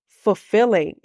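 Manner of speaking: ordinary delivery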